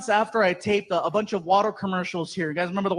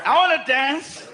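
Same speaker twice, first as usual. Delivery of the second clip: slow, laughing "I wanna dance"; tipsy giggling answer; giggly drunk reply; 5 semitones higher, slower than usual. Retold or imitated. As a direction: drawl it tipsy through a laugh.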